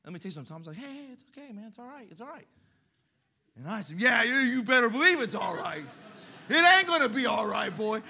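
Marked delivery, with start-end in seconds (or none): 0.8-2.5 higher, softer placating voice; nervous backing down. Retold or imitated. Bully soothes the boy in a higher, softer placating voice. 4.0-8.0 loud, defiant warning; tough shout. Retold or imitated. Boy shouts down the bully, loud and defiant.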